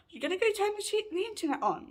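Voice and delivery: quick, high mock nagging; high-pitched mimicked demand. Housemate repeats her internet demand, high and quick.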